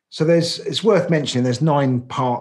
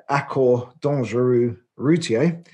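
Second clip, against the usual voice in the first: self-mocking attempt at a French accent; halting French accent on the name; 3 dB louder than usual. Shown as a demonstration. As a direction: say it the French way, not very well. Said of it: "forgive my appalling French accent".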